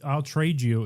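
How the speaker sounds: ordinary delivery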